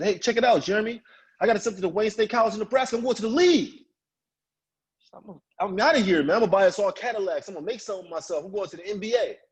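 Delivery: high-pitched, boastful announcement; acted-out youthful big talk; excited bragging. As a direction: brag to your buddies, all hyped up. Brags excitedly to his friends.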